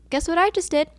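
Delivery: high, teasing reveal; excited announcement. Retold or imitated. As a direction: re-enact springing big news on him. Retelling her big announcement to him.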